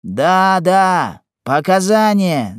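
loud, high clamour; yelling crowd. A narrator's reading. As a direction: cry out like a crowd demanding it. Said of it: "завопили трибуны". (вопль толпы)

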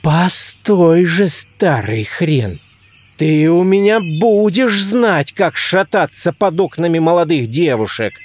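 slow, rising indignant warning; drawn-out, emphatic threatening; vengeful threat. Acted. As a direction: vow payback, pitch climbing at the repeat. Levko vows revenge on his father.

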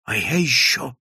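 ordinary delivery, nothing marked

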